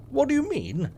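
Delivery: put-on high, puzzled child asking; little girl voice. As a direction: ask it like a confused six-year-old. Child self questions narrator.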